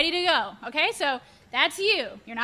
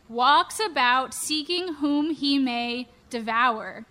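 ordinary delivery

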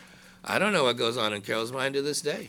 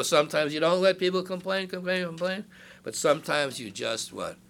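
ordinary talk, nothing marked

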